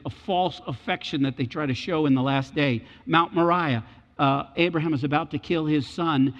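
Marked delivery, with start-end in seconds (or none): none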